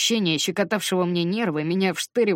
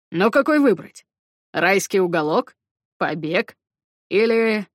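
put-on loud young man; 2 semitones higher, 6 dB louder than usual. Bram voiced, loud.

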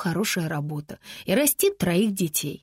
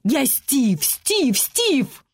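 angry repetition climbing higher each time; insistent, building; 5 semitones higher, 4 dB louder, much slower than usual. A narrator's reading. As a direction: hammer out СТИВ harder and higher with every repeat. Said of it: "повторяя своё имя, он всё сильнее ударял". (сердито, с нарастанием, всё выше)

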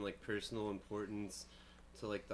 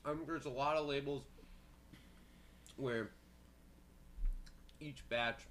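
mouth full; 5 semitones higher than usual. Real, not acted. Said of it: "sorry, I'm eating a cookie".

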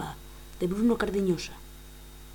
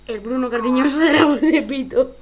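loud announcement; 11 dB louder than usual. Pretend. Dramatic announcement.

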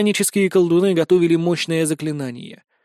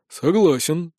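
ordinary delivery, nothing marked

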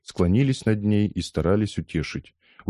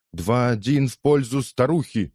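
mildly shouted, higher voice; called-out score; 6 semitones higher than usual. A narrator's reading. Boxing fan scoring the fight, lightly shouted. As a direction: call the score over a crowd. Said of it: "выкрикнул кто-то".

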